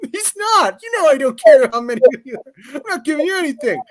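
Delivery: high-pitched mock protest; protesting kid. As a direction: play a bullied kid squeaking back. Bullied kid protests in retold story.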